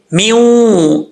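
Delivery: "میوں" with a long hummed oo; rounded nasal waw. Shown as a demonstration. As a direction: round the lips and hold "میوں". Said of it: "میوں پڑھیں گے". ("میوں" غنہ کے ساتھ لمبا)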